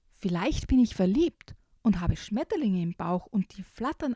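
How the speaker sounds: put-on reading a picture book to children; lively storybook reading; story narration. Reads the picture book aloud.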